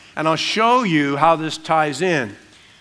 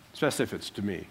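joking aside; 8 semitones lower, 11 dB quieter, much faster than usual. Real, not acted.

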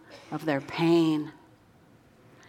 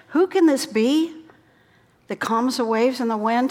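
amazed exclamation; 5 semitones higher, 5 dB louder than usual. Acted. Disciples marvel at calmed storm.

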